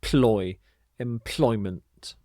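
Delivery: ploy said alone, then employment slowly with em-PLOY-ment stressed; slow employment with the weight on ploy; stress the middle syllable. Shown as a demonstration. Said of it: "you put the stress on this middle s".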